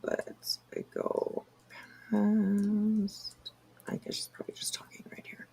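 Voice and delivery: whispered aside. Real, not acted. Muttered aside while skipping.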